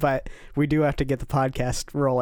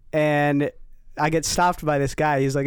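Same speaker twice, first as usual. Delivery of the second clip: usual speaking voice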